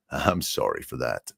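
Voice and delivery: low, soft radio DJ murmur; late-night FM DJ voice. Retold or imitated. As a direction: drop into a smooth late-night radio apology. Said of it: "Mister Late Night F M D J voice".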